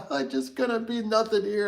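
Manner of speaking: high, hammed-up whimper; mock weeping. Stranded man whines in mock despair. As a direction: fake a little sob, pitched high. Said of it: "doing, like, the, like, weeping".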